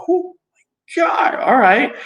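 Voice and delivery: high, loud mock-stunned exclamation; husband reacting to his tough wife with a high, loud stunned cry; shocked outcry. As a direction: cry out in amused disbelief.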